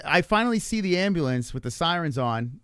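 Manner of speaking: usual speaking voice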